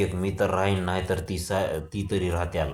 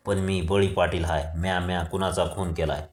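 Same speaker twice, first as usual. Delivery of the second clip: ordinary delivery, nothing marked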